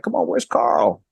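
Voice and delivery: high-pitched retold complaint; exasperated. Fan asking after an actor left out of the cast, exasperated. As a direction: protest the missing name, voice lifted high.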